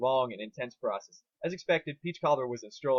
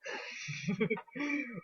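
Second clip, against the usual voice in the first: high gleeful laugh; 10 semitones higher, 7 dB quieter than usual. A narrator's reading. Twilight's sudden idea.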